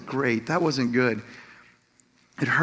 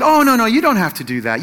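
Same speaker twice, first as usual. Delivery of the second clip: mock protest, louder and higher at the start, easing off; polite protest; 4 semitones higher, 6 dB louder, faster than usual. Wife refuses husband's offer to drive, polite mock protest. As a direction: imitate a wife waving off the offer.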